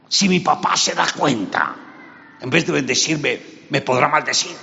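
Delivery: high, loud pleading son; a worried young man protesting to his mother; high anxious pleading; anxious Jacob. Acted.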